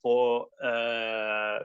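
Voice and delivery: normal talking voice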